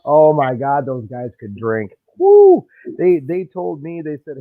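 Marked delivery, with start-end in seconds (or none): 2.2-2.6 a high, loud woo of amazement. Real, not acted. Man whooping about Canadians who could drink, high woo. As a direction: whoop at how much they drank.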